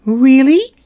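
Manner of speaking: high, doubtful little squeak; a tiny queen not quite believing; surprised mouse queen. Acted. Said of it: "asked the mouse".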